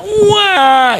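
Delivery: very high, sustained mock wail; retold yell of pain. Preacher re-enacts screaming over his bleeding hand, long high wail. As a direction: howl over the hurt hand, hammed-up. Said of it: "saya teriak".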